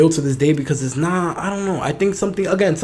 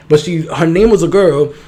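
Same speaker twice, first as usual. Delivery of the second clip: ordinary delivery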